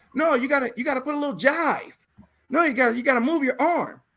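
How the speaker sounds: fast, high, shouted coaching. Retold or imitated. Teacher urging actors to move their arms, fast shout. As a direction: bark the jive directions fast. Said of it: "teacher voice again".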